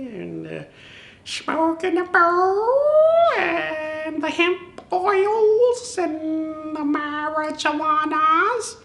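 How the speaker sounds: high, slow, spaced-out drawl; stoner voice. Retold or imitated. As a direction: float it out like a dreamy stoner. Stoner riff on hemp brand.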